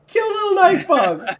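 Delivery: put-on high, loud baby talk; baby talk cooing. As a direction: coo at it like a pet. Petting the bug, baby talk.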